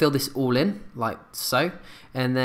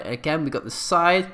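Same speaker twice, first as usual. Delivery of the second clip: ordinary talk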